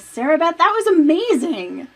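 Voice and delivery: host praising the storyteller; excited praise. Real, not acted. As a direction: gush over the performance.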